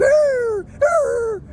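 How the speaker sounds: high, dopey gaping "arr"; mouth-open mock moan; mocking gawkers. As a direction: mimic slack-jawed gawkers moaning up at the sky. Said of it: "with their mouths open going".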